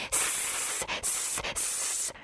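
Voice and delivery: excited snake hisses; wordless hissing in snake language; snake-speak hissing. A narrator's reading. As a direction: hiss in short bursts like an eager snake. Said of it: "vykřikl Ríša nadšeně ... z hadí řeči".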